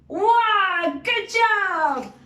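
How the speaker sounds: high, loud praise; excited. Acted. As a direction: cheer them on brightly. Excited praise after knock.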